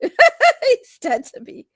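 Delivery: slow, high laughing "dead to me"; laughing mock drama. Real, not acted. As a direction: laugh through a fake grave line. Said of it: "laughing mock drama".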